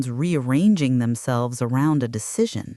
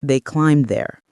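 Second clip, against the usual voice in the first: ordinary delivery, nothing marked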